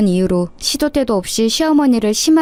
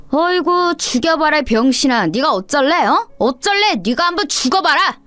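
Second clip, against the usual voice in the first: higher, sneering schoolyard bully voice; a mocking kid bully, pitched up; taunting bully; 6 semitones higher than usual. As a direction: jeer at the limping boy from up high.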